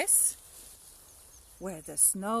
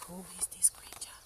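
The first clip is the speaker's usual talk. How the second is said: fast, scared whisper; frightened whisper; 12 dB quieter, faster than usual. Acted. Gruffalo's child sees the shadow, whispering scared.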